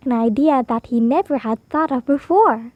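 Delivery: ordinary delivery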